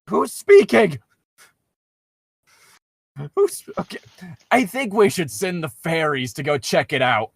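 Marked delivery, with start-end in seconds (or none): none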